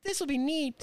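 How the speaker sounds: high-pitched mock enthusiasm; squeaky "what a great idea" delivery; naive past self. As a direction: quote your clueless younger self in a high, chirpy voice. Mocks his own naive past self.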